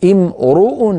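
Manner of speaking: امرؤ as imru, the r rounded; a rising emphasis on the damma. Shown as a demonstration. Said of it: "لاحظوا بأن الراء مضمومة".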